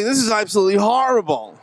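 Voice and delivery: high-pitched mock agony; comic distress. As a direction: wail it high, hammed-up misery. Distressed patient blowing on himself.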